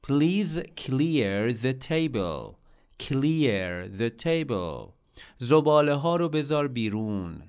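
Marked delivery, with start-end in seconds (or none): 0.1-4.9 Please clear the table, each word slow and distinct; slow clear model. Shown as a demonstration. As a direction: lay it out slowly for learners to copy. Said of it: "لطفاً میز رو تمیز کن".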